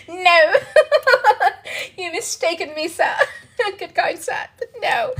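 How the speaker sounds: high, laughing mock British accent; giggling fake posh British accent: "Good kind sir"; terrible British accent. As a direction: play a prim Brit refusing politely. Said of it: "I have a terrible British accent".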